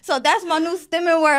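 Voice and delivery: normal talking voice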